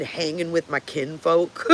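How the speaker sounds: slow, laughing through the quote; amused at the shirt slogan, laughing. Real, not acted. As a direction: crack up as you read the shirt.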